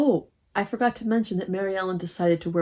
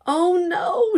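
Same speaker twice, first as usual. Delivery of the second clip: high, loud dismayed shriek; 11 semitones higher, 5 dB louder than usual. Acted. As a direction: shriek in horror at the mess. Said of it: "shrieked Joan".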